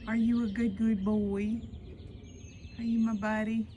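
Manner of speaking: loud, bright pet questions; talking to a dog. Real, not acted. Asking dog baby-talk questions.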